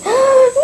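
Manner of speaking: high yelp of suffering; sharp cry of pain. Real, not acted. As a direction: yelp as if hurt. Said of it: "j'ai fait un cri de la souffrance".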